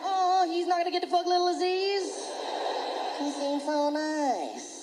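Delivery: high, mock-tender pity voice; mock sympathetic audience.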